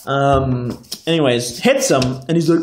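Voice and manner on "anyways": usual speaking voice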